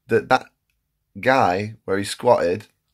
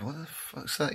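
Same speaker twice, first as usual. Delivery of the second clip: startled hush; 6 semitones higher, 16 dB quieter than usual. Retold or imitated. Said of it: "And I'm like".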